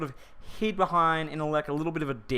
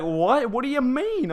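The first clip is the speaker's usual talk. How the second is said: puzzled; 10 semitones higher, 4 dB louder, slower than usual. Retold or imitated. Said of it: "And he's like".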